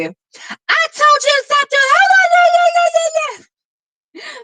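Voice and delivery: very high, loud screechy scolding; shrill tone. Shown as a demonstration. Teacher showing students a shrill character voice, high screechy scolding. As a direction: shriek it slowly and piercingly, far above normal pitch. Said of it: "And shrill would be".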